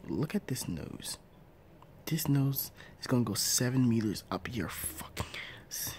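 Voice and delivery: breathy low menace; whispered threat. Acted. Parent threatening spouse, whispered.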